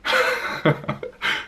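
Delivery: high, unvoiced snickering laugh; a rapid run of breathy chuckles. Real, not acted. Reviewer amused mid-warning to certain viewers, snickering laugh.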